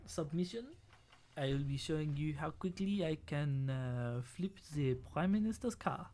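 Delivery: halting mock-French contestant; French accent. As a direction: present a talent act in a put-on French accent. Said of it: "Do they have a France Has Talent?".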